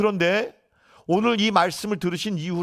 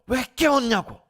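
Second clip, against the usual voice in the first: 높은 음으로 꾸짖는 말을 흉내; 따져 묻듯 높여 재연하는 꾸중; 꾸짖기; 8 semitones higher than usual. Retold or imitated. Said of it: "제자들에게 꾸짖으셨어요". (높은 목소리로 꾸짖는 흉내)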